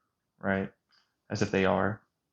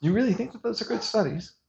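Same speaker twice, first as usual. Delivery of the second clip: much higher incredulous voice; sarcastic disbelief; 9 semitones higher than usual. Real, not acted. Challenging viewers who trust those studies, higher and sarcastic. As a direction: ask it as if the idea is absurd.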